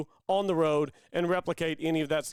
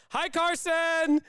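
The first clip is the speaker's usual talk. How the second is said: high, drawn-out excited call; toddler's yell; 11 semitones higher, much slower than usual. Retold or imitated. Dad imitating his toddler yelling into the podcast call, high drawn-out yell. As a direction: imitate a little kid yelling hello. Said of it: "pop her head on the screen and yell".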